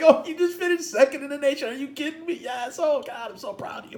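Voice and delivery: high-pitched emotional gushing that trails away; fighting back tears. Retold or imitated. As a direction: reenact a choked-up proud dad. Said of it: "fighting it back".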